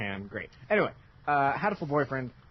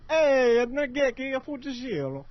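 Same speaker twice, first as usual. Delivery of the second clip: high, loud cartoon Italian; Italian accent; 13 semitones higher, 4 dB louder than usual. Streamer reading the bird's name, exaggerated Italian accent. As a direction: belt the name out as a mock Italian. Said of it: "read that with an Italian accent".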